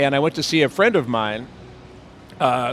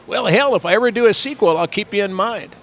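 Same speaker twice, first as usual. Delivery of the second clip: higher mock voice of the director; lightly hammed curious director; imitating Tobe Hooper; 7 semitones higher, 3 dB louder than usual. Tobe asks Bill who played the hitchhiker, higher mock voice.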